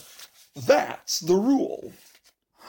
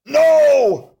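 loud, higher "No!"; loud despairing cry; 6 semitones higher, 7 dB louder than usual. Pretend. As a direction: shout it in mock despair. Reader cries out.

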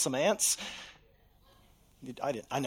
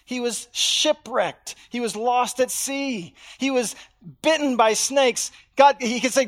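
usual speaking voice

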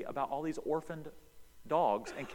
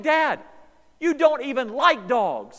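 loud, high disbelieving cry; incredulous son; 13 semitones higher, 15 dB louder, slower than usual. Retold or imitated. Son yelling in disbelief at his crying dad, loud and high. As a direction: shout your amazement at your father. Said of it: "I'm like".

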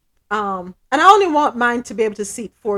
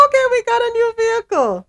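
excited; 13 semitones higher, 5 dB louder than usual. Retold or imitated. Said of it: "I was like".

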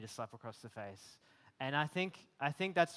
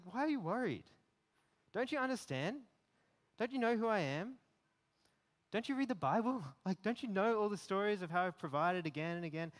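higher, pressing, rising frustration; exasperated Jesus; 4 semitones higher, 4 dB louder than usual. Retold or imitated. Jesus reminding a worried believer, higher pressing plea. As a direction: insist like a loving teacher out of patience. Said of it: "imagining him speaking to me about that".

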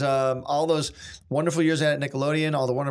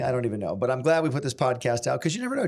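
usual speaking voice

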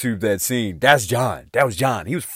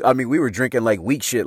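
ordinary talk

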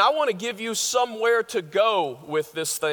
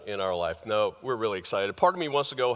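usual speaking voice

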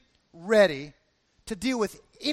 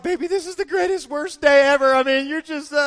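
high-pitched, swelling loud in the middle; mock hysterics, imitating her laughing through the words; imitated laughing wife; 6 semitones higher, 7 dB louder than usual. Wife laughing at her husband's hurt back, mock hysterical. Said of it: "she just laughs".

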